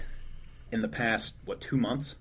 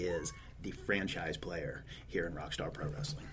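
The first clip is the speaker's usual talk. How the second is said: promo announcer; 5 dB quieter than usual. Acted. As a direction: sell the main event, dropping to a near whisper. Pay-per-view hype announcement.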